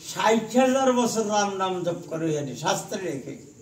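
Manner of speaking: ordinary delivery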